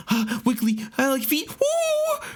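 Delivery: high squeaky giddy voice; hammed-up giddy squeal; mock excitement. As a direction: imitate him going crazy, ending in a high woo. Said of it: "this man starts getting fucking excited".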